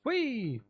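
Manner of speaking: long high "whee" gliding down; drawn-out falling cry of fun; high playful whee. Pretend. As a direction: squeal a gleeful whee, sliding down. Playful exclamation while travelling.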